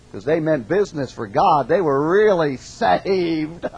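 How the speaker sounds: normal talking voice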